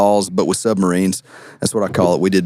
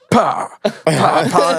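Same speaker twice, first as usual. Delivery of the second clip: high-pitched "pa" impact sounds; imitated splat noises; 8 semitones higher than usual. Imitates paper balls hitting the ground.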